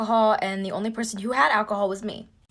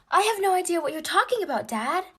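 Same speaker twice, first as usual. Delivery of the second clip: higher, playing dumb; feigned innocence; 8 semitones higher than usual. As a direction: act like you know nothing. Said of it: "Pretending like I don't know what's going on".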